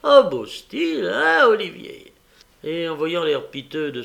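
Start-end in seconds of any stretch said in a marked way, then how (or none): 0.0-2.1 high, loud gleeful jeer; насмешливое веселье. A narrator's reading. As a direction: jeer in a much higher, louder voice. Mocking glee in story scene.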